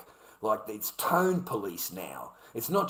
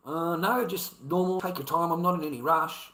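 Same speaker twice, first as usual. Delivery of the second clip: friendly; 5 semitones higher, 4 dB louder than usual. Shown as a demonstration. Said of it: "I continue the convo".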